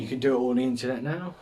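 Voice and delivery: usual speaking voice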